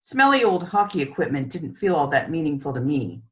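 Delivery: plain reading voice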